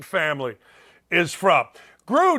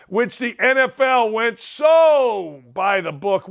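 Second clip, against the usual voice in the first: normal talking voice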